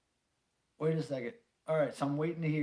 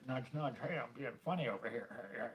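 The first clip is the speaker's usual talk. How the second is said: softer, quick nudge-nudge joker; lightly mock a wink-wink comedian, softer and fast; mock jokey voice; 3 semitones lower, 8 dB quieter than usual.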